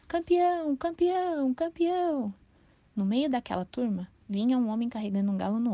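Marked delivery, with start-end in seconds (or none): 0.0-2.3 crowd yelling. A narrator's reading. A crowd on the corner yells for the champion. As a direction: cheer like a street crowd. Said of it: "um bando de gente apareceu na esquina berrando".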